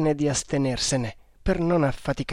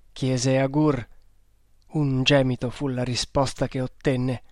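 plain reading voice

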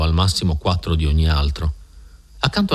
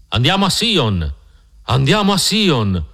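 crowd cry; 7 semitones higher, slower than usual. A narrator's reading. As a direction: call it out slowly on a raised pitch, without real shouting. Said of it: "urlò la folla di mormoni".

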